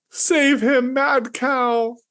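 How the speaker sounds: high pleading plea; begging. Acted. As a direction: beg desperately. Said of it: "I begged".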